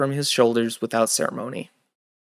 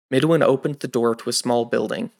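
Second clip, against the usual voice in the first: usual speaking voice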